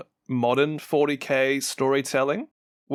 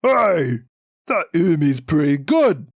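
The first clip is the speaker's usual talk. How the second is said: lightly gruff orc grunt, louder and higher; 3 semitones higher, 5 dB louder than usual. Retold or imitated. Podcaster voicing an orc knob for co-host, loud orc growl. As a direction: play a dumb orc deciding to tag along. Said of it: "one of the orc knobs ... was like".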